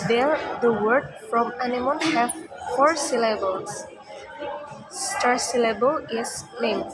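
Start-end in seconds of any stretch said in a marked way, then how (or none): none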